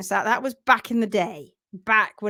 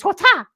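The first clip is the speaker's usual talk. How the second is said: loud high karate shout; loud martial arts yell; kung fu cry; 10 semitones higher, 8 dB louder than usual. Retold or imitated. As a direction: yell it like a ninja kick. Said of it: "and just".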